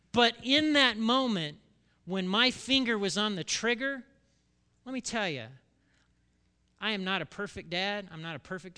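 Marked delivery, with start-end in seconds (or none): none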